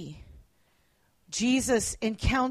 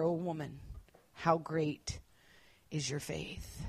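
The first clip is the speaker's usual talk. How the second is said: tender praise; 8 semitones lower, 11 dB quieter than usual. Acted. Jesus praising the woman.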